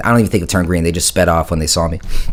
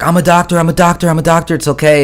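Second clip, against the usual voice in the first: urgent doctor; 9 semitones higher than usual. Retold or imitated. Bystander jumps up shouting to the bleeding friend, high and urgent. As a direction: call out fast that you can help. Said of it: "this one guy jumped up, and he was like".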